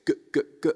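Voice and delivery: short, crisp "g, g, g" with a hard onset; balanced hard attack. Shown as a demonstration. Coach modelling the G for online singers, "g, g, g" as balanced hard attacks. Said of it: "nice balanced heart attack".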